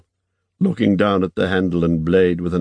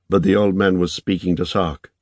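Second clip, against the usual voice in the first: ordinary delivery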